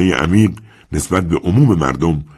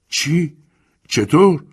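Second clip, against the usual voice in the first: high, flustered what-how; startled; 12 semitones higher, 3 dB louder, much slower than usual. Acted. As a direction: caught off guard, stammering. Saro startled by accusation.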